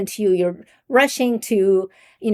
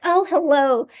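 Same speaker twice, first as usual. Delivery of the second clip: higher, pleased hello for a friend; different greeting voice; 6 semitones higher than usual. Acted. Answering when a friend is calling, brighter changed voice. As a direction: lift the pitch as if greeting a caller. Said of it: "your voice is gonna be different".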